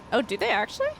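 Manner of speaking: usual speaking voice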